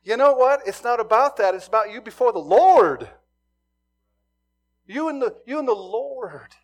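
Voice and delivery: loud, high, insistent preaching; preaching at full force. Real, not acted. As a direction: drive the point home. Preaching climax.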